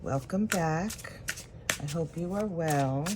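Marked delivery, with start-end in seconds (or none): none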